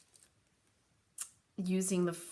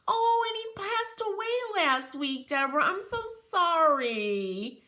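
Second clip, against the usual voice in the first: sympathetic sorrow; 9 semitones higher than usual. Real, not acted. Viewer reports her cat died.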